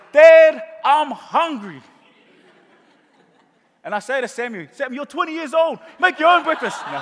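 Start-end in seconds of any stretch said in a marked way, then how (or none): 0.1-1.8 put-on loud high child's call; high, loud childish call for dad; kid calling dad. Kid bursts in hungry. 4.7-6.8 higher, louder mock-scolding father; hammed-up fed-up dad; exasperated dad. Father scolds grown son.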